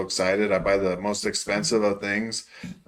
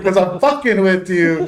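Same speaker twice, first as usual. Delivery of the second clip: raised pitch, cracking up while retelling; amused, barely holding in laughter; laughing confession; 8 semitones higher, 4 dB louder than usual. Retold or imitated. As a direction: laugh through the prank reveal.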